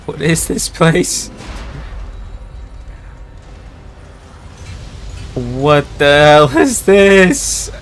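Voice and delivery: high, loud frantic panic; panicked high voice. Real, not acted. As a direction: yelp it out in terror. Panicking in mental hospital.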